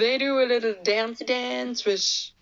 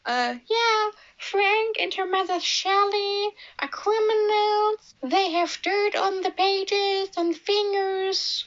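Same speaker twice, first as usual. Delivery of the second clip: high, hammed-up tattletale voice; mocking the coach; 11 semitones higher than usual. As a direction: squeal the accusation like a parody. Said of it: "he was like".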